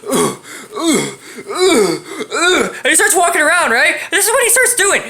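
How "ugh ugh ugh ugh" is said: imitated groaning. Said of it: "the dude goes".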